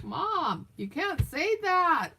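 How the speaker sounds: high, drawn-out mock protest; retold scandalized objection, stretched out; exasperated daughter. Daughter objecting to her mom's remark, exasperated.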